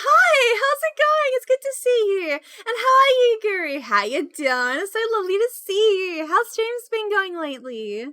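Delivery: high, loud gushing squeal; excited greeting. Real, not acted. Streamer welcomes Nightmare and Guru, excited squeal.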